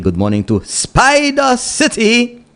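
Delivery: playful sing-song greeting. Acted. Host playfully greets a district.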